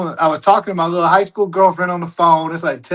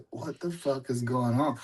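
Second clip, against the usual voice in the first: scared hush; 6 semitones lower, 14 dB quieter than usual. Retold or imitated. Scared in bed at night.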